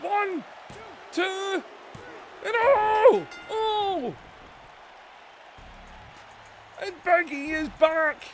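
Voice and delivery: high, loud, breathless wrestling commentary; excited play-by-play. Acted. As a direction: call the match like every move is a shock.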